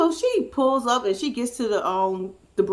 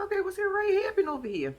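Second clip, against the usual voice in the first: high, quick bewildered aside; imitating puzzled onlookers in a high, soft voice; puzzled reaction; 9 semitones higher, 6 dB quieter, faster than usual. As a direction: ask it high, baffled. Group's puzzled reaction acted out.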